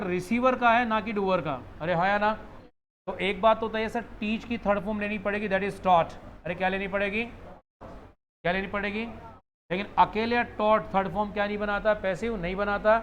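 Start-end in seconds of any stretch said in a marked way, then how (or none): none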